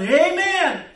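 high, loud hollered church Amen; sudden congregant's shout, high and drawn; hollering. Retold or imitated. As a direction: holler it out from the back pew. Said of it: "he starts hollering".

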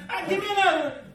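high-pitched mock gambler's demand; eager gambler. Losing gambler demanding another ticket, mock eager cry. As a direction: imitate a hooked gambler calling out. Imitating scratch ticket losers buying more.

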